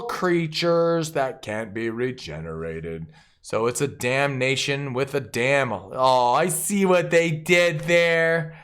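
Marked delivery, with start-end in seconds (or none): none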